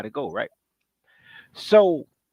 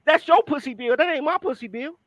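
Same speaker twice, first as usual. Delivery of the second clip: heated retort; 8 semitones higher than usual. Retold or imitated. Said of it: "I said".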